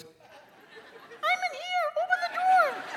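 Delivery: tiny high-pitched tempting voice, very slow; a little voice calling from the drawer. Acted. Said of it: "little voice".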